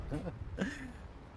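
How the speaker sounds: short high-pitched giggle; a quick squeaky laugh at himself; amused little laugh. Real, not acted. Laugh after near crash.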